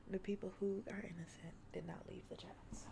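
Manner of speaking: hushed whispered aside. Real, not acted. Streamer whispering a sly aside to chat. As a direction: lean in and whisper it.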